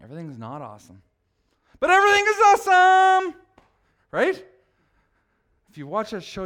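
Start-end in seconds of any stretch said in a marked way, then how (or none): 0.0-1.0 quiet admission. Acted. Quiet truth after forced cheer. 1.8-4.4 forced cheer. Pretend.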